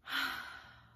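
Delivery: long, audible demonstration sigh; big sigh. Shown as a demonstration. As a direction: sigh out loud for the kids. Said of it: "Can you sigh with me?".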